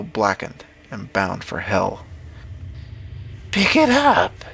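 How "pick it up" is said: high, drawn-out witch voice. A narrator's reading. Witch ordering the farmer to pick up the claymore, high witch voice. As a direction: pitch it up high as a commanding witch.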